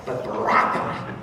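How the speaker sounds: "baraka" gritty and breathed, rolled r; punchy, edgy "baraka"; with edge. Acted. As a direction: give "baraka" some chutzpah. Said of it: "a word that's got some chutzpah".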